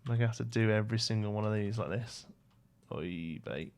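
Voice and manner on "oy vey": soft, low Yiddish-accented "Oy vey"; a quiet, lower Yiddish-accent sigh of dismay; mumbled Yiddish-accent "Oy vey"; Yiddish-tinged sigh. Real, not acted.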